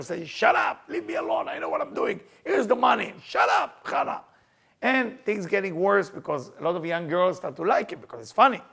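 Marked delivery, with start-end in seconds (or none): 0.3-4.2 1 angry. Retold or imitated. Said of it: "he getting very angry with her".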